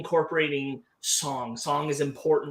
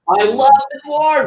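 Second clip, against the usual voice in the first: loud, high cry of enthusiasm; real fan outburst, high and loud; excited shout; 12 semitones higher, 14 dB louder than usual. Real, not acted. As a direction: shout your love out at full volume. Bursts out over favorite topic.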